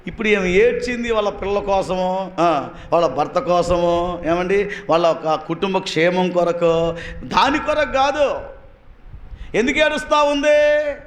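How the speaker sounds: high, ringing preacher voice at full force. Acted. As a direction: preach it hard to the congregation. (ఉత్సాహంగా బోధించడం)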